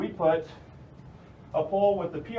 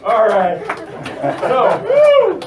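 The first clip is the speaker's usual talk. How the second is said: talking through laughter, louder; laughing; 7 semitones higher, 14 dB louder, much slower than usual. Real, not acted. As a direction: keep laughing while you talk. Still laughing after the song.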